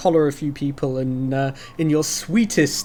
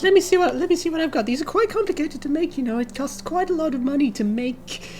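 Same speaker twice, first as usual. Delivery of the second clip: put-on much higher, gushing girly voice; high girly half-orc; 13 semitones higher, faster than usual. Half-orc blacksmith gushes at the bard in a high girly voice. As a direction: gush like an excited schoolgirl. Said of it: "and she says".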